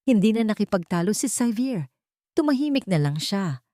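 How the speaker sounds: plain reading voice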